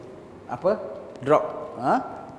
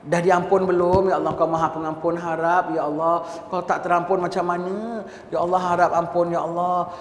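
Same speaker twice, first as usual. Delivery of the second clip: pleading elder asking if sins are forgiven, a little louder; fearful repentant prayer; 2 semitones higher, 8 dB louder than usual. Acted. Sixty-five year old sinner praying to Allah, fearful plea. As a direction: beg God for pardon, anxious. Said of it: "Nangis takut".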